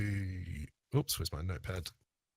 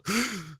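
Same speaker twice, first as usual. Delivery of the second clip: crowd gasp; 11 semitones higher than usual. Acted. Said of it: "you kind of hear a gasp".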